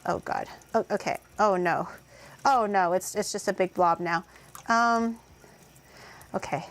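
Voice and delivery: normal talking voice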